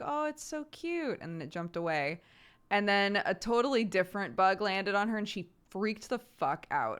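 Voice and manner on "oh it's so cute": put-on a high, sweet little-girl coo over a bug; lightly voiced delighted kid; cooing child. Mother imitating her daughter cooing at a grasshopper, high and sweet.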